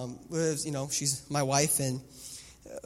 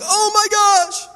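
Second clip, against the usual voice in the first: much higher, louder, slow horrified cry; shocked gasp; 14 dB louder, much slower than usual. Retold or imitated. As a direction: react as your kid hits the wall. Daughter knocked into wall.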